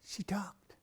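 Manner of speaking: whispered in awe; hushed whisper. A narrator's reading. Narrator whispering in wonder that the girl finally spoke. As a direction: breathe it like a secret.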